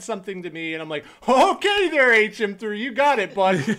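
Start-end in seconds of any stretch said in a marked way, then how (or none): 1.3-3.8 loud, high-pitched sarcastic eagerness; hammed-up fake obedience, much louder; mock compliance. Sailor answering a third class who pulls rank, mocking compliance.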